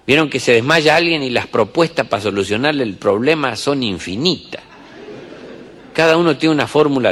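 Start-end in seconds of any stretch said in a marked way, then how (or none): none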